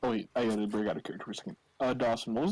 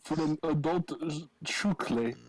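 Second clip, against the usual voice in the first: put-on broken-English Transylvanian accent; Vladislav's Transylvanian accent; 5 semitones higher, slower than usual. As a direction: play a Barovian with a halting Transylvanian accent. Plays Vladislav the Barovian in game.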